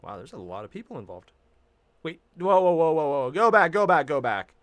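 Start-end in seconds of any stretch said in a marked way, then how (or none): 2.0-4.5 loud, rapid-fire urgent interjection; urgent alarm. Real, not acted. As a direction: call out to halt it at once. Startled reaction to on-screen credits.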